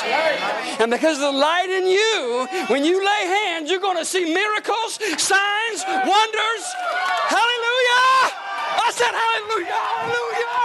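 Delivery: high, building to exultant hallelujah shouts; full-force preaching. Real, not acted. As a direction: preach it upward into a soaring, drawn-out cry.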